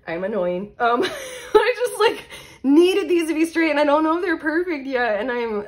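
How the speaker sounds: high, loud laughing whine; embarrassed laughing fluster, pitch climbing; laughing self-mockery. Real, not acted. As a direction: squeal through laughter about your own fussiness. Laughing at her own fussiness.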